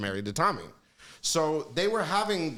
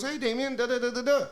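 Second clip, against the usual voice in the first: Michael's voice; 8 semitones higher than usual. Retold or imitated. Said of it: "and he goes".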